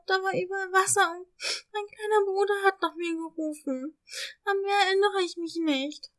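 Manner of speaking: quietly crying. Acted. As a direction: tell it through soft tears. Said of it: "Leise weinend begann sie zu erzählen".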